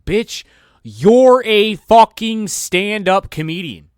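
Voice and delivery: slow, loud, high yell; exasperated yell. Real, not acted. Yelling at preachy comedian, slow and loud. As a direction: drag it out and yell it. Ranting at Dave Chappelle.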